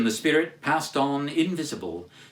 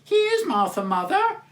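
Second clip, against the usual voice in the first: put-on much higher squealing children's cry; excited children; 3 dB louder than usual. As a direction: cry it out like excited little kids. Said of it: "cried the two young Cratchits".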